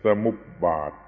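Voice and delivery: สมุปบาท the Pali way, sa-mup-pat with a p; Pali pronunciation. Shown as a demonstration. Teacher citing the Pali form, สมุปบาท said sa-mup-pat. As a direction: say the last syllable of สมุปบาท as pat. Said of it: "บาลีมันเป็น".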